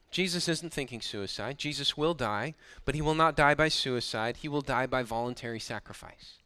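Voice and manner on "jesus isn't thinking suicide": ordinary delivery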